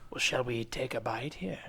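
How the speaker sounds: hushed conspiratorial invite; whispering. Acted. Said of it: "the reason we're whispering".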